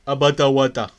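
loud, exaggerated British accent, bottle water with dropped t's; mock British accent. Teasing the British way of saying bottle water, in a British accent. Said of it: "se ríen los estadounidenses de los ingleses".

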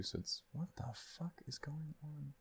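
sudden puzzled whisper. Real, not acted. Streamer whispering to himself at the glitching stream, baffled whisper.

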